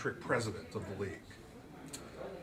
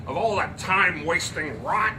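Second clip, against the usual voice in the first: higher, louder scorn; scornful father; 6 semitones higher, 7 dB louder than usual. Acted. Andrew to the group, scornful. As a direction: spit out the contempt.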